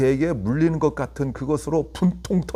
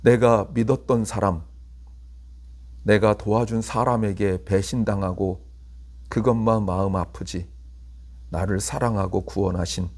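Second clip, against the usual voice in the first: prayer; 6 semitones lower, 4 dB quieter than usual. Acted. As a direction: pray humbly and quietly. Closing prayer.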